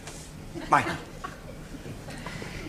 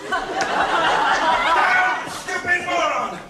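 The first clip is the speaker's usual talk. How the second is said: much higher, louder, very slow drawn-out yell; drawn-out furious shout; 4 dB louder, much slower than usual. Acted. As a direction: stretch every word of the insult out, yelling. Furious doctor rants at assistant.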